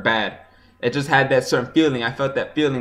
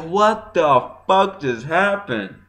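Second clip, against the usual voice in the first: stunned disbelief; 4 semitones higher, much slower than usual. Retold or imitated. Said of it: "I was in awe".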